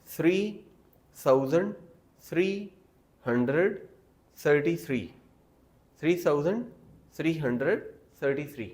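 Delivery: थ्री and थाउजेंड with soft th, word by word; careful soft th. Shown as a demonstration. Teacher drilling numbers with soft th. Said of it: "आप कैसे पढ़ेंगे".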